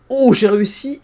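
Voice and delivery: proud burst of relief; excited. Real, not acted. Triumph at holding in sneeze.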